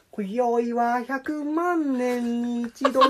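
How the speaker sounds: very slow, drawn-out crooned line. Retold or imitated. Impression of the singer for his friend, very slow. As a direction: stretch every syllable like a moody singer. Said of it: "ディージェー、[name]だよ".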